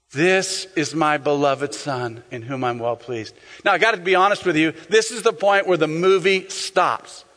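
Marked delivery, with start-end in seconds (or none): 0.1-3.3 low, slow divine proclamation; voice of God. Acted. Preacher voicing God after the baptism, low and slow. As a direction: lower the voice and take it slowly. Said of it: "you hear the voice of God".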